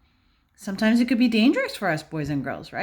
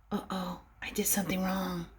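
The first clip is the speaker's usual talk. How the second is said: guilty whisper; 2 semitones lower, 12 dB quieter than usual. Acted. Voicing guilty Clifford.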